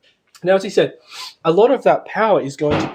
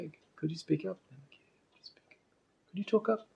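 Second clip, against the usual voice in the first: quiet; 5 semitones higher, 14 dB quieter than usual. Retold or imitated. Asking a quiet person to speak.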